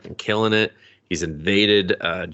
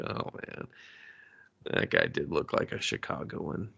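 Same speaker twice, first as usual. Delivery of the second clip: much softer whispered aside; hushed aside; 5 semitones lower, 11 dB quieter than usual. Real, not acted. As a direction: whisper it to yourself. Quiet aside about the guy.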